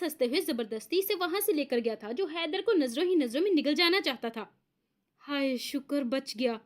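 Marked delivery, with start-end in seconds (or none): 5.2-6.6 relieved sigh. Acted. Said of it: "गहरे सांस लेते हुए".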